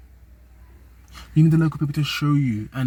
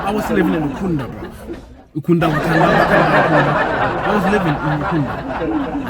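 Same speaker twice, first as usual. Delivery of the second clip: chuckling through the words, a bit higher; laughing; 4 semitones higher, 4 dB louder than usual. Real, not acted. Vlogger joking to viewers about Ukunda and Wakanda, laughing. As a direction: giggle as you play Ukunda against Wakanda.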